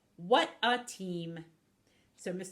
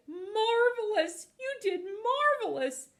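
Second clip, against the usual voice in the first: gushing praise; 12 semitones higher, 5 dB louder than usual. Acted. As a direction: gush with pride, loud and stretched. Wolf gushing praise in read-aloud story.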